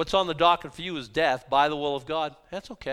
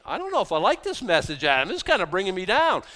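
mock complaining congregant; 5 semitones higher, 6 dB louder than usual.

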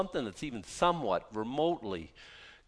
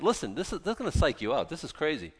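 usual speaking voice

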